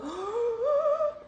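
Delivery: sharp high gasp of delight. Real, not acted. Surprised at the soft shirt.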